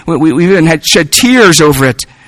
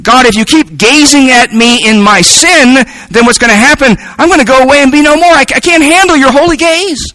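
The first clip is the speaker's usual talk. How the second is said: rising, anxious plea climbing much higher, faster toward the end; a man overwhelmed, begging God to look away; pleading with God; 6 semitones higher than usual. Acted. Preacher voicing David begging God under his holy gaze. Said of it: "What he is saying is".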